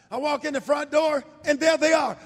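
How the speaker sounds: ordinary delivery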